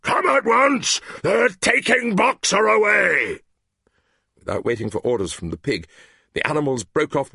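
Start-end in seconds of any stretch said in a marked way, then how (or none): 0.0-3.4 high, loud shout, slowing at the end; alarmed shouting. Acted. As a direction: cry the alarm to the whole farm. Benjamin alarms the animals.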